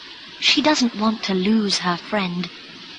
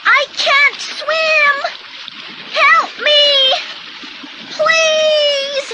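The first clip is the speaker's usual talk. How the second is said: put-on very high, loud child's yells; panicked high-pitched pleading; shouting for help; 4 dB louder, slower than usual. A narrator's reading. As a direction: cry out desperately as a drowning boy. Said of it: "he's shouting".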